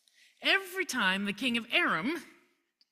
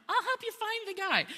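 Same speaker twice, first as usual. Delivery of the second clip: innocent cover-up; 11 semitones higher than usual. Acted. Elisha covers his slip, innocently.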